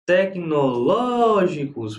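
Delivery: "Tecnológicos" with LÓ long and high; stress on the tonic syllable. Shown as a demonstration. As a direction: stretch LÓ slowly in "Tecnológicos". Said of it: "proparoxítona".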